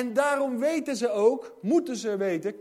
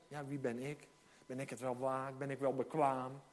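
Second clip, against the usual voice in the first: self-doubting mumble; 8 semitones lower, 11 dB quieter than usual. Retold or imitated. Doubting believer voiced.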